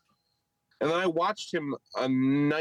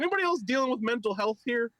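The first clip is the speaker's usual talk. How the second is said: high, quick parody question; mock comic voice; 9 semitones higher, faster than usual. Retelling his jab at the previous comic, high and quick. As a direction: rush it in a pitched-up mock voice. Re-enacting his mocking opening line.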